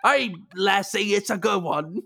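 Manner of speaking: mock Scots-Irish accent. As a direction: draw it out in fake Scottish-Irish. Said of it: "it is for the Scott Irish".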